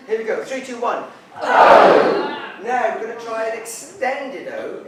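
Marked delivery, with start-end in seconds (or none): 1.4-2.5 audience shout. Real, not acted. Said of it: "Oh dear".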